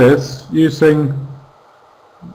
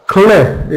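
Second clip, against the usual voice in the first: Cli pulled out alone and pushed up higher as the stressed beat; stressed syllable; 4 semitones higher than usual. Shown as a demonstration. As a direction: isolate Cli and punch it. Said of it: "it's stress on the I".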